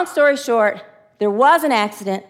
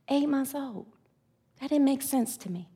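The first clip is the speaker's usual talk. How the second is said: quiet grief; 2 semitones higher, 10 dB quieter than usual. Real, not acted. Grieving after outburst.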